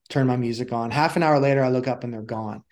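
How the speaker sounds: usual speaking voice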